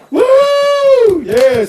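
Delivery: big shouted cheer; excited whoop. Real, not acted. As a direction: whoop loud for the guest. Host hypes up arriving guest.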